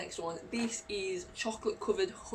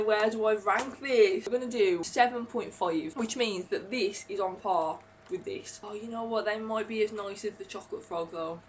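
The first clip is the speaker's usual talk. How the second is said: ordinary delivery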